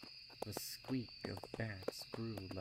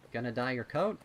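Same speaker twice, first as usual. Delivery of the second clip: put-on higher, louder kid voice; colt voice; 4 semitones higher, 10 dB louder, faster than usual. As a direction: play a curious young colt. Rumble voiced in reading.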